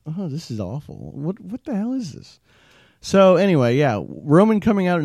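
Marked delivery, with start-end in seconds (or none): 0.1-2.4 soft, pained, disbelieving groan; hushed disgust. Retold or imitated. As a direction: murmur it like a letdown. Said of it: "And I'm like".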